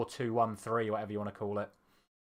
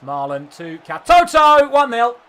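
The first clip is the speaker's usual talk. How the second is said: loud, rising goal shout; excited goal call; 9 semitones higher, 14 dB louder, slower than usual. Real, not acted. Scores a goal.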